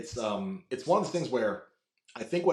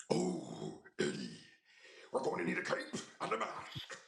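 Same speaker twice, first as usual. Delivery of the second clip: Venom; 6 semitones higher, 11 dB quieter than usual. Acted. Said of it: "what was that voice?".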